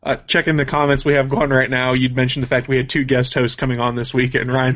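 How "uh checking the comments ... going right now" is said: normal talking voice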